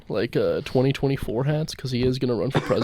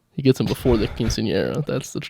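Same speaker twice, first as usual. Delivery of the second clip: ordinary talk, nothing marked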